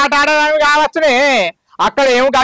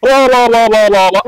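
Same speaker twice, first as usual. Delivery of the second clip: loud mocking heckle; sing-song taunting jeer at the daughter-in-law; 3 semitones lower, 4 dB louder than usual. Mother-in-law mocks daughter-in-law.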